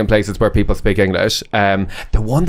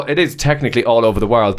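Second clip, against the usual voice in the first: ordinary talk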